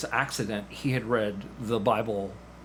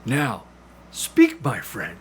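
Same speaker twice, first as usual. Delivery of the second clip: DeLacy urging; 2 semitones higher, 6 dB louder, slower than usual. Acted. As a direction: coax the pupil, then command speak. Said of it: "and he says".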